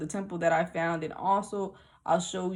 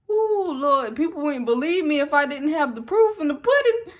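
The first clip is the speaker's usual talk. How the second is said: high, loud, thrilled outburst; excited exclaiming; 8 semitones higher, 6 dB louder than usual. Retold or imitated. Telling God people wouldn't believe without pictures, excited exclaiming. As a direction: call it out high to the Lord, thrilled. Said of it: "I just got excited".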